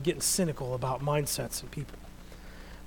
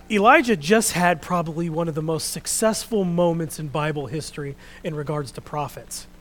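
usual speaking voice

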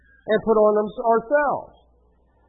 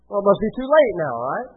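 usual speaking voice

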